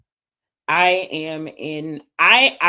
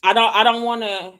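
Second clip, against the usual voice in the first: pleading; 7 semitones higher, 7 dB louder than usual. Real, not acted. Emotional wish to stop surviving.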